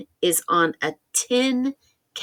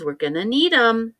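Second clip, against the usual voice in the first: ordinary talk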